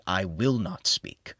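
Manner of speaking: ordinary delivery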